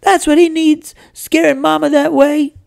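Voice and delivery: very high, loud indignant wail; bawling little sister. A narrator's reading. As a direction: wail the accusation as a tattling sister. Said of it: "puckered up and started bawling".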